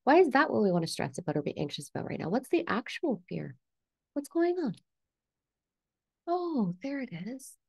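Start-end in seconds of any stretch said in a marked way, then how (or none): 4.1-7.6 high, soft, wondering; gently spotting something, pitched high; curious discovery. Acted. Coach acting out noticing the actual fear, high soft curiosity.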